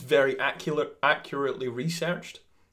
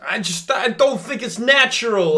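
angry rant; 10 semitones higher, 11 dB louder than usual. Acted. Comic rant, shouted.

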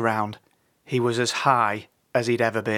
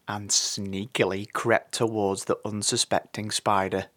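ordinary delivery, nothing marked

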